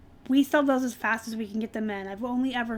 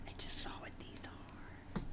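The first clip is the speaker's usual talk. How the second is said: breathy whispered aside to herself; 21 dB quieter than usual. Real, not acted. Reseller whispering to herself on seeing the jeans. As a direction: whisper it under your breath.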